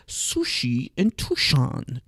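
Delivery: lightly imitated Vietnamese accent, slow. Quoting friend Hin Vu in a light Vietnamese accent. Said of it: "she has that Vietnam accent".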